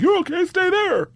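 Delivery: high, slow sing-out; mock reassurance. Cop supposedly telling student to stay, mock reassurance. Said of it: "but it doesn't sound like".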